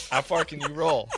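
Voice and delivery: squealing high with laughter; laughing. Real, not acted. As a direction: laugh through the question. Laughing mock question.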